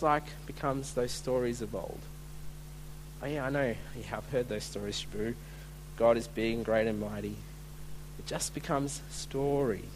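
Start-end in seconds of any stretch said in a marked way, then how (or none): 3.2-7.4 low, hushed, throwaway acknowledgement; soft, low and unimpressed; jaded dismissal. Acted. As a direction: mumble it off like an old, tired story. Voicing jaded adults shrugging off stories.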